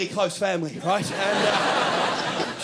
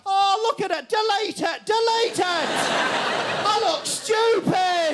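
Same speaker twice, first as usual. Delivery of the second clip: frantic delete it; 9 semitones higher, much slower than usual. Retold or imitated. Friend seeing a bad photo of themselves, high frantic squeal. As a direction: squeal to get the photo gone.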